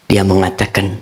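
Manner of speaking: normal talking voice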